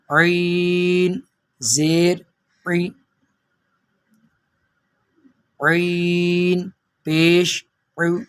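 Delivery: عین stretched slowly from the throat; ain throat sound. Shown as a demonstration. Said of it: "عین کی آواز کو نکالنا ہے". (حلق سے عین کے ہجے)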